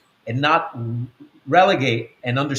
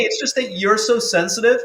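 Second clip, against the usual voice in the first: mocking sister; 9 semitones higher than usual. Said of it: "But she goes".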